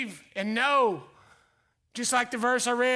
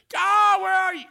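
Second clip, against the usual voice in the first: much higher, louder desperate call; mock frantic prayer; pleading shout; 8 semitones higher, 8 dB louder than usual. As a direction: cry out to God as if lost. Acted plea to God.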